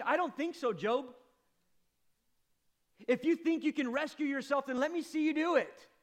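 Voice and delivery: loud, high, building rebuke; preacher voicing God rebuking Job; God's thunderous challenge. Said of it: "He says to Job".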